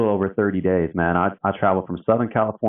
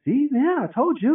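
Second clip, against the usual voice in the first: much higher teasing told-you-so; playful gloat. Retold or imitated. Worker gloating to his doubtful boss, high teasing told-you-so. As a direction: rub it in with a grin.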